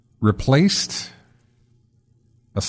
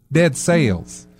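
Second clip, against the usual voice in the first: slow, higher Southern drawl on "Dead Sales"; Southern accent; 4 semitones higher, slower than usual. Acted. Podcast host joking about a game title, in a Southern accent. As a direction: stretch Cells into "Sales" like a Southerner. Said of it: "or really southern pronunciation".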